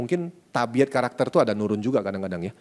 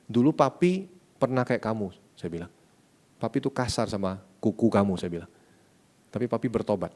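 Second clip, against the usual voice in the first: soft, tender counsel to his son; father quietly counseling his son after a fight; gentle father; 4 semitones lower, 5 dB quieter than usual. Retold or imitated. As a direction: speak softly like a loving dad comforting a crying child.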